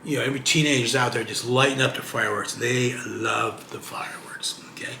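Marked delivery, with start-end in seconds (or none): 2.5-4.9 whisper. Pretend. Whispered aside about fireworks.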